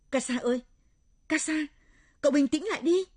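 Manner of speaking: high, urgent shout to a friend. Acted. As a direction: yell to snap her out of it. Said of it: "Tôi thét lên".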